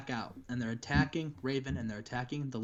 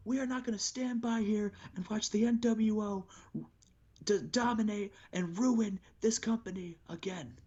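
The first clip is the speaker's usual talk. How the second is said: wrestler promo; 8 semitones higher than usual. Retold or imitated. Raven calling out Hogan, a high angry promo. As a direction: cut a furious promo at Hogan. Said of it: "He says".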